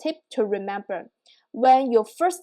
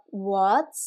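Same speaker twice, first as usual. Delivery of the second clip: "what" rising like a question; 2 semitones lower than usual. Shown as a demonstration. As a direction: ask "what" with an upward glide. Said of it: "Use the same tone to say that".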